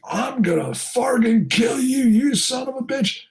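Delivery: slow, high-pitched mock threat; retold angry yell at normal volume; unglued father. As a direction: imitate a man losing it, drawing out the threat. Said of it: "The father comes unglued".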